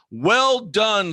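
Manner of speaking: applaud the chatter, jumping up in pitch; delighted praise. Real, not acted. Praising a chatter's trolling comment.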